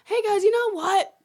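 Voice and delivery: high, loud call to the group; tour guide excuse. Retold or imitated. Fake guide excusing herself from tourists, bluffing cheerfully. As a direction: grab their attention with a bright put-on pitch.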